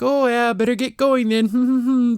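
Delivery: high, drawn-out mock politeness; awkward excuse. Voices girl's nervous excuse.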